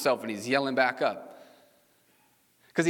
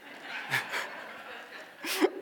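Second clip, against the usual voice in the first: breathy laugh; 6 dB quieter than usual. Real, not acted. Pastor laughs at son's logic.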